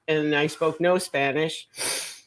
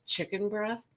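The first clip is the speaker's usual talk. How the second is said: puzzled quiet question; 5 semitones higher, 9 dB quieter than usual. Retold or imitated. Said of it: "And she goes".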